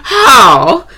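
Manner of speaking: slow, loud, hammed-up southern accent; mock southern accent. Host opens the podcast in a mock southern accent, slow and loud. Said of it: "giving me a southern accent, so I decided just to, you know, roll with it".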